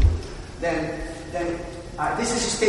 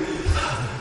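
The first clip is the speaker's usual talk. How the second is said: long low sigh of relief; relieved sigh; 8 semitones lower, 3 dB quieter than usual. Shown as a demonstration. Drama teacher demoing the genie's relief. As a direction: breathe out the fear. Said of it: "like that".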